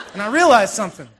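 words bubbling with real laughter, pitch jumping up; laughing while telling. Real, not acted. Pastor laughing as he tells the congregation about the tissues. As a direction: laugh through it, tickled by your own story. Laughing at himself in movie story.

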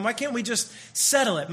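ordinary talk

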